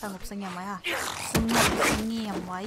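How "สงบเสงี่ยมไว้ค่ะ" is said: softly, reminding herself to keep quiet; hushed. Shown as a demonstration. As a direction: drop the voice low and quiet. (พูดเบาๆ แบบกระซิบเตือนตัวเอง)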